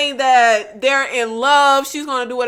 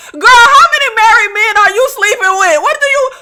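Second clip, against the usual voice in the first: loud, high, disbelieving rant with laughs; incredulous outburst; 6 semitones higher, 6 dB louder, faster than usual. Real, not acted. Host reacts to Samantha's phone call.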